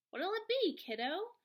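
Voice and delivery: a hint of a cheerful old grandma; Grams voiced. A narrator's reading.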